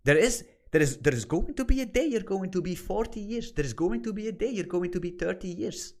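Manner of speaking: usual speaking voice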